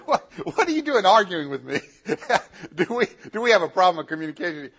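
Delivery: high mocking laugh, then quick jeering words; cackling demon.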